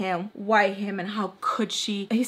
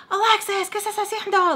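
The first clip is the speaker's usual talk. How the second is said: high, raised calling-out voice; pitched-up nosy demand; Mister Peach; 11 semitones higher than usual. Retold or imitated. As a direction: imitate someone calling sharply in Spanish. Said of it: "then I hear Mister Peach".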